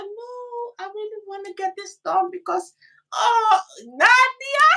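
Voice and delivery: high, pleading little whine; baby voice. Shown as a demonstration. Woman wheedling to get her way, baby voice. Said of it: "a baby voice".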